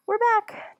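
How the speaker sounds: high, loud announcement; calling out. Acted. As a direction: call it out cheerfully. Justin calling out on return.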